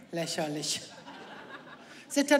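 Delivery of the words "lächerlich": low, quiet scoffing "lächerlich" with a laugh; dismissive scoff. Retold or imitated. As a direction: brush it off as ridiculous. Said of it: "Ich sag, Pff".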